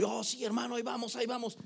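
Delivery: high, soft, light reassurance; mock everything-is-fine reply; put-on cheeriness. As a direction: brush the brother off in a high voice. Putting on a brave face.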